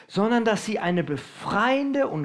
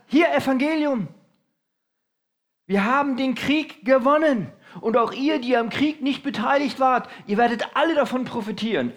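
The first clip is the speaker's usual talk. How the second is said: higher, louder triumphant proclamation; victory messenger; 6 semitones higher, 4 dB louder than usual. Retold or imitated. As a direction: call out the victory to the city. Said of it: "ein Botenläufer kommt vom Schlachtfeld zurück".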